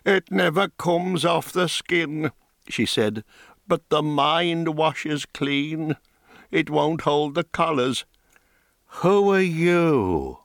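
a hint of a grieving crone losing her words; old woman rambling sadly of lost power; half-mad old dyer woman. Old woman speaks to mage.

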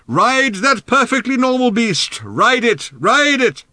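high, drawn-out holler; distant shouting. A narrator's reading. Old man cheers riders from afar, shouting. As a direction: call after them from far away. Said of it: "His distant voice reverberated in their ears".

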